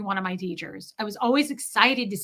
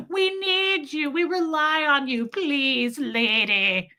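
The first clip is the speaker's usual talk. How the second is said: much higher, slow, pleading pet voice; the pets' voice; 4 semitones higher, slower than usual. Retold or imitated. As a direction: plead like a needy little animal. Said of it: "where they're like".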